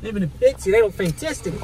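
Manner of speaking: loud, hammed-up Aussie delight; Australian accent. Praising drinks at the window, Australian accent. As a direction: exclaim like a broad Australian. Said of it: "he was the most Australian Australian".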